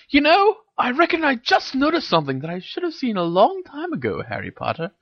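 high-pitched knowing taunt. A narrator's reading. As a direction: taunt him high, dropping on the name. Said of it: "she taunted".

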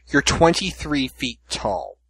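slow, measured delivery; putting it gently. Real, not acted. Host breaking it gently to a friend afraid of a roach, slow and deliberate. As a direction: spell it out slowly and carefully. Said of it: "gonna put this gently".

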